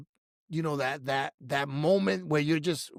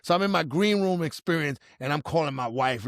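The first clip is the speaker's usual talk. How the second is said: ordinary talk, nothing marked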